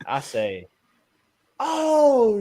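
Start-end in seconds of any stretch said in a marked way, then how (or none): 1.6-2.4 shocked gasp. Retold or imitated. Seeing his broken leg.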